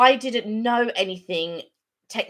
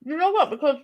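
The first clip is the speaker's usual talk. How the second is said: talking through a mouthful of cake; mouth full; 8 semitones higher, 3 dB louder than usual. Real, not acted. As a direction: speak around a bite of food. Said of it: "I'm talking with my mouth full".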